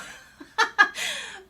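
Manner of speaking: high bright laugh that falls away; a real laugh bursting out; burst of laughter. Real, not acted. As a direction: laugh out loud at the memory. Laughs at child eating sweets.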